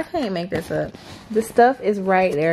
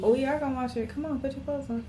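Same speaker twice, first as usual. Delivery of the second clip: much softer gentle urging; soft coaxing; 4 semitones higher, 9 dB quieter, faster than usual. Real, not acted. Mother softly telling her son to get dressed.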